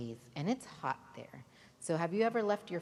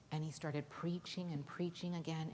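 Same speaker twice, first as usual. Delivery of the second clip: usual speaking voice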